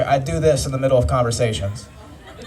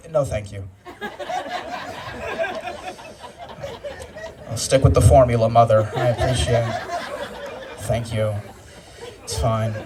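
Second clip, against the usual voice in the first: put-on prim polite baby; 3 semitones lower than usual. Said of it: "my first words were".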